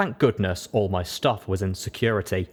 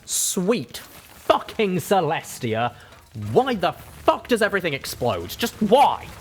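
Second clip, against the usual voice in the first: angry shout; 10 semitones higher, 4 dB louder, slower than usual. Acted. Building explodes, she swears.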